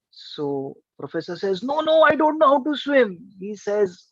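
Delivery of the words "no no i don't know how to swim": high, urgent denial; acted panic. Scared professor admits it to Raju, panicky. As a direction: plead in mock alarm, pitch raised.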